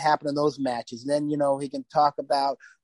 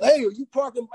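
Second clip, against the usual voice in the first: higher, pushy Italian-American tough guy; Sopranos thug; 9 semitones higher, 3 dB louder than usual. Retold or imitated. Tony hassling a driver on the street, Sopranos thug voice. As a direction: lean on it like a mobster from The Sopranos. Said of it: "The Sopranos or something".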